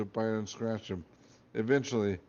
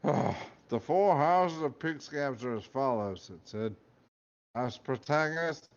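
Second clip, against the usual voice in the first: weary Scottish hat; 2 semitones higher than usual. Acted. Hat lists houses wearily.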